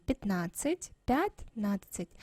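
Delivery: ordinary talk, nothing marked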